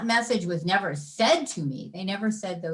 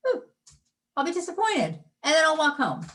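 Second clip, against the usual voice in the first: carefree; 8 semitones higher, faster than usual. Retold or imitated. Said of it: "Do it from that".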